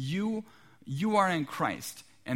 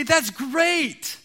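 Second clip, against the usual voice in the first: much higher and louder, sugary sales pitch; overly cheery missionary; 12 semitones higher, 9 dB louder than usual. Retold or imitated. Visitors at the door replying to the homeowner, mock-gushing. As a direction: gush it like a pushy door-to-door evangelist. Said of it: "And they say".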